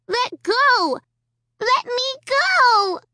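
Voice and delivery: put-on high child's cry, angry and stretched out; a toddler yanking free in anger; angry little girl. A narrator's reading. Little Alicia yanking at the puppet's grip, angrily crying. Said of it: "Alicia cried angrily".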